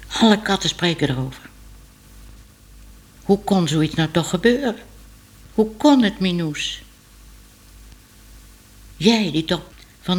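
put-on a stately old cat clucking over scandal; old cat Moortje. A narrator's reading. Narrator voices old cat.

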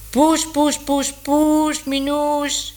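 high, drawn-out call across the rooftops; calling from afar. Acted. Man calling Minoes over the rooftops, high and drawn out. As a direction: call the cat from far away, singsong. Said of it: "riep de stem".

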